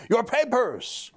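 high, slow mock order of "Your papers!"; mock police demand. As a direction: bark it like a checkpoint cop.

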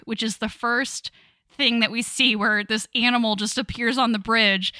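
normal talking voice

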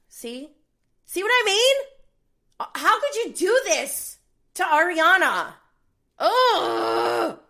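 high, loud wailing complaint; mock outrage. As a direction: wail in fake outrage. Mock-outraged cry about Ariana.

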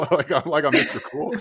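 amused at his past self; laughing. Real, not acted. Telling the audience about his naive greeting, laughing.

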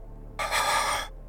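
shuddering voiceless exhales after crying; heavy laboured breaths. Acted. Kanan pulling themselves together, heavy laboured breathing. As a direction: breathe out hard, no voice. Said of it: "the heavy labored breaths".

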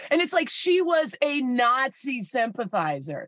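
ordinary delivery

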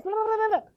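high rapid babble; mimicked speaking in tongues. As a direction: imitate a burst of tongues. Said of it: "You'll speak in other tongues, just like this".

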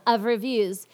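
usual speaking voice

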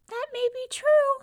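very high, loud little mouse squeak; tiny voice. A narrator's reading. Unseen mouse answers the disappointed young man in a tiny voice. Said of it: "came a tiny voice".

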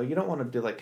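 ordinary talk, nothing marked

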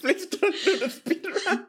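high-pitched begging through laughter; mock pleading. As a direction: plead helplessly while laughing. Begging co-host not to speedrun.

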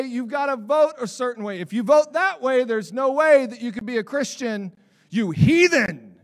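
loud, scolding condemnation, slowing on you heathen; a hammed-up preachy scold. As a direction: lampoon a self-righteous believer berating an outsider.

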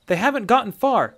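high, loud protest; eager young soldier. A narrator's reading. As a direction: urge the officer on. Young soldier urging pursuit.

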